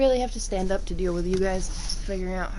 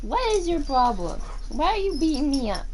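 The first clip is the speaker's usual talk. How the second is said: higher, louder indignant yelling; real pained outrage; 8 semitones higher, 3 dB louder than usual. Real, not acted. Owner yelling as her pets pull her hair. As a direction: yell at the pets in pained disbelief.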